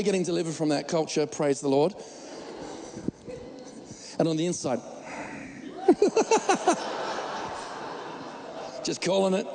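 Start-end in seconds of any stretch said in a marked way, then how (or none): 4.9-8.8 high laugh. Real, not acted. Laughing at own joke.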